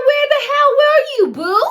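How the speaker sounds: very high, loud mock-furious demand; exaggerated yell. Girlfriend interrogates boyfriend, hammed-up furious yell. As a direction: blow it up into an over-the-top shriek. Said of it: "I didn't say it like that".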